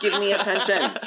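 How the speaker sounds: high, loud whiny plea with a laugh; squealing attention-seeking pet; needy dog whine. Pretend.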